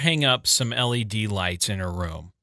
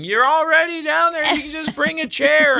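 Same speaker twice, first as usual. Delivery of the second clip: much higher, louder yell from upstairs; daughter yelling; 13 semitones higher, 4 dB louder than usual. Retold or imitated. Daughter in bed yelling at dad in the kitchen, high and loud. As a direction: yell it down the stairs at him. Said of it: "And she's yelling".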